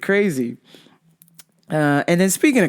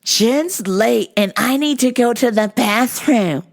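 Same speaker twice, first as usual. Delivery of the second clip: a lighter mocking tattletale voice, slow; whiny coworker; 6 semitones higher, slower than usual. Coworker reporting Jen to the boss, lightly mocking. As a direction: drag it out like a snitch. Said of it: "telling our boss".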